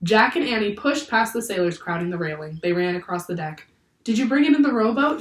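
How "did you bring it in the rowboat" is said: fast, high, loud question; yelled question. A narrator's reading. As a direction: yell it urgently on the run. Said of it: "yelled Annie".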